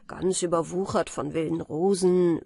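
usual speaking voice

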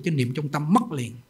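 usual speaking voice

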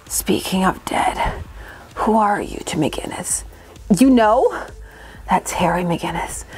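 low whispery cartoon villain voice; slow theatrical villain menace. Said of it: "more of a mwahaha Saturday morning cartoon villain".

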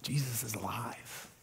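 breath-soft whisper, low; hushed, intimate and very soft; whispered reassurance. Real, not acted. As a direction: whisper the good news to each listener. Same message given to the congregation.

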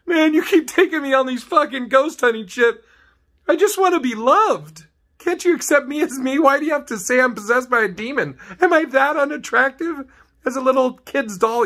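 high, whiny sad doll pleading; creepy doll begging to be loved, high and whiny. Acted. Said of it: "Maybe the doll's like".

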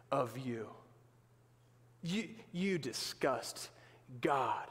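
drip contempt as the accuser shaming the sinner; accusing Satan. Acted. Satan condemning the believer after the sin, in a slow low sneer. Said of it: "comes back and he says".

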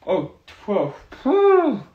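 sharp high loud exhale of fright; real jolt of surprise; startled whew. Real, not acted. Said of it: "it scared me".

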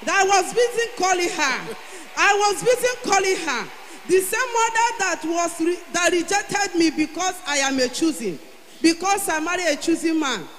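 loud, high rising testimony; excited testimony. Real, not acted. Testifying to the church with a triumphant shout. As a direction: build it to a triumphant cry. Testimony climax, congregation cheering.

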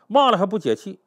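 ordinary delivery

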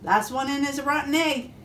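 playful storybook chick; cheeping chick. A narrator's reading. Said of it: "cheeped the chick".

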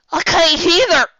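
high loud tearful cry. Acted. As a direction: cry it out through tears. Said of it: "tears flowing from her own eyes".

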